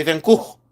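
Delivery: Gogh as "goo", questioning; imposed pronunciation. Shown as a demonstration. Seller asking if she must, Gogh as "goo". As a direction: reluctantly try Gogh as "goo". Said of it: "Do I have to say".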